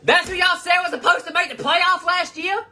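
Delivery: high-pitched gloating taunt; mocking. Real, not acted. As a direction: squeak it out to taunt the fans. Mocking rival team's fans.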